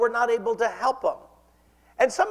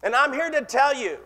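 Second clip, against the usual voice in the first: normal talking voice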